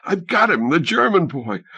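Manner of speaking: high excited boast. A narrator's reading. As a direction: crow over the catch. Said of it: "Helene's voice was high, excited".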